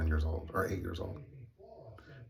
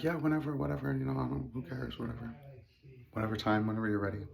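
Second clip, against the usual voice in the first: higher-pitched mock nonchalance; offhand agreement; 6 semitones higher than usual. Father telling his child any time is fine, offhand. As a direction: toss it off like you couldn't care less. Retold father's careless go-ahead.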